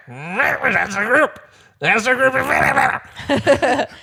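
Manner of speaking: high, very slow, drawn-out mock warning; slow sing-song Angela. Angela warning Quinn, drawn out high and slow. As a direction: stretch it out high and eerie. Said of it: "she was like".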